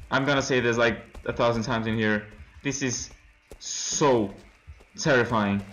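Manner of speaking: ordinary delivery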